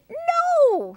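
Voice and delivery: high, whooping correction; high-pitched chimp exclamation; storybook chimpanzee. A narrator's reading. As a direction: hoot it high like an excited chimp. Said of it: "said chimpanzee".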